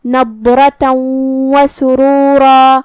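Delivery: slow, drawn-out Quranic reading of the two heavy ra; tajweed demo. Shown as a demonstration. Teacher showing tafkheem on سرورا, slow and heavy. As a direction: draw each word out slowly, full mouth. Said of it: "یہ حکم کو ادا کریں گے".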